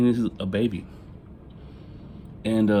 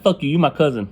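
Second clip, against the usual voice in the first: half-joking snap, louder and higher; mock angry retort; 7 semitones higher, 5 dB louder than usual. Teasing his little brother with a mock angry retort. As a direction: bark it at your little brother in jest. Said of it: "I'm like".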